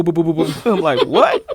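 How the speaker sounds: normal talking voice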